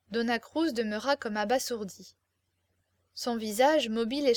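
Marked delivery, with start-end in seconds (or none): none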